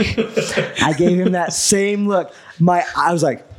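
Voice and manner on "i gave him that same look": slow, higher, laughing through it; slow mock-dramatic replay with a laugh; drawn-out pointed look line. Shown as a demonstration. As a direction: stretch each word out with a laugh.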